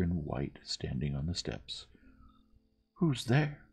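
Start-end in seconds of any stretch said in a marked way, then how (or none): 3.0-3.6 high-pitched challenge; crying out. A narrator's reading. Youth challenging the figure on the steps, crying out. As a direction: call it out high, demanding. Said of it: "he cried".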